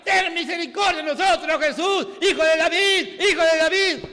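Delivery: loud high cry for mercy; blind man shouting. Retold or imitated. Bartimeo shouts to Jesus, loud desperate cry. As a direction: cry out to Jesus at the top of your voice. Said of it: "Si gritaba Bartimeo".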